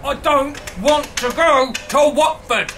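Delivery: exasperated. Real, not acted. Arguing with the machine.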